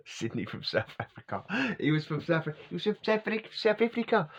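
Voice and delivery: put-on rising, high-pitched South African accent stumbling over the name. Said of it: "I can't keep doing his accent".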